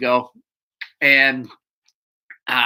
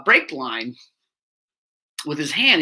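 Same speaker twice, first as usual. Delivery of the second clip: usual speaking voice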